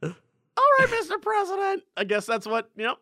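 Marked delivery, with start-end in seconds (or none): 0.0-1.8 put-on very high falsetto girl. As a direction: pitch it way up as a mock girl. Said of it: "that's my girl voice".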